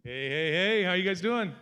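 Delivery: high, loud hello to the crowd; loud greeting. Real, not acted. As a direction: call out to the room, pumped up.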